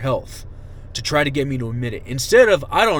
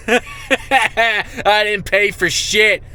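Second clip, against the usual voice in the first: laughing scorn; 8 semitones higher, 4 dB louder, much slower than usual. Real, not acted. Laughing at the unit's threat.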